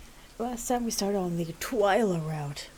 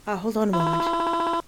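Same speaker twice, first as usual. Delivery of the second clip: high, slow mourning mother; a frail mother lingering on every word; high drawn-out grieving mom; 10 semitones higher, 4 dB louder, slower than usual. Acted. Mother character speaks.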